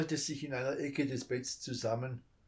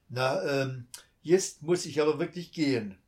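apologetic smile; 3 semitones higher, 7 dB louder than usual. A narrator's reading. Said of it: "mit einem entschuldigenden Lächeln".

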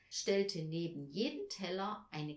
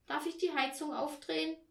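higher-pitched, lightly voiced child's request; child's plea; 7 semitones higher than usual. A narrator's reading. Barbie to mother about the heating, child's plea. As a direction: ask as a chilly child, pitched up.

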